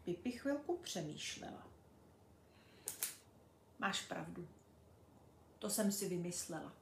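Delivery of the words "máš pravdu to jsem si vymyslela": quiet, softer and subdued, owning up; sad admission. Acted. Pipi admits to Tomi she made it up, quiet and sad. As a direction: let the fib go with a quiet sigh. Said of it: "řekla smutně Pipi".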